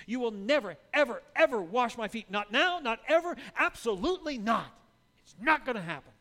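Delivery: loud, high, pounding each never; adamant refusal. Retold or imitated. Peter loudly refusing Jesus, never ever. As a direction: dig in your heels and shout it. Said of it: "in the strongest language possible".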